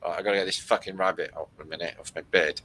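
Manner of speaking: usual speaking voice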